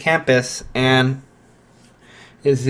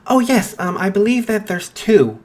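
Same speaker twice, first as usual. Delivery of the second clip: Nezu's voice; 8 semitones higher than usual. Acted. Nezu voiced, higher.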